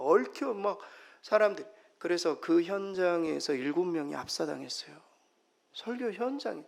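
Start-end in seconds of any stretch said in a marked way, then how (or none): none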